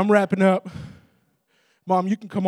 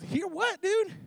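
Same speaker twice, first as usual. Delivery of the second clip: baffled dad; 14 semitones higher, 5 dB quieter, much slower than usual. Retold or imitated. Dad asking his son what he hears, mock bafflement. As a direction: voice jumping high on the question.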